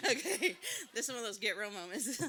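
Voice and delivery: laughing loudly through the words; a real burst of embarrassed laughter. Real, not acted. Speaker laughing at her own blunt prayer, talking through laughter.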